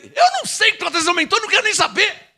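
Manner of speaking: fed-up preacher's cry; exasperated rebuff. Acted. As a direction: shut the complainer down at high pitch. Rebuffing the complainers.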